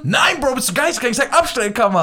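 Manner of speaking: high, loud disbelief; indignant. Real, not acted. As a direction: scoff at the question. Indignant reply to silly question. (empört)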